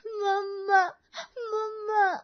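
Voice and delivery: high, tearful wail calling for mommy; a scared little girl crying out for her mother; crying child. Acted. Frightened child calls for mother. (डरी हुई बच्ची का रोना)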